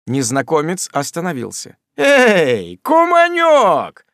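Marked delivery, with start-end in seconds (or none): 2.0-4.1 high calling shout, drawn out. A narrator's reading. Sam calls loudly to the stranger, high drawn-out shout. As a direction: call out to the stranger across the yard. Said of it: "повторил Самуэль".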